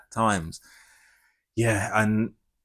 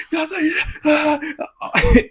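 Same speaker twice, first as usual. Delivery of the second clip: high, loud whoops; imitated cheering; 6 dB louder than usual. Joining the cheering cinema audience, re-enacted excited cheering. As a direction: cheer along, caught up in the crowd. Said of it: "Everyone else, like, cheering".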